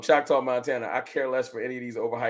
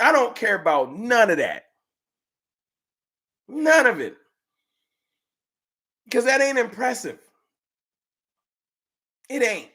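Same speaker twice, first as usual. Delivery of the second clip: scornful dismissal; 7 semitones higher, 3 dB louder than usual. Real, not acted. Dismisses rival fans.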